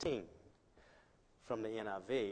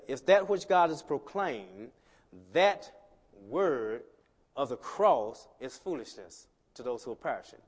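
slow, rising emphatic proclamation; preaching; 7 dB louder than usual. Acted. Preacher stressing the word of the cross. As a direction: build it word by word.

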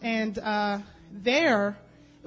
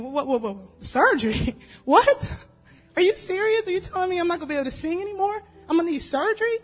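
higher and louder, tumbling out in disbelief; shocked disbelief; 5 semitones higher, 4 dB louder than usual. Retold or imitated. Telling the church about the doctor, retold shocked disbelief. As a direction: retell the jolt of hearing surgery is needed.

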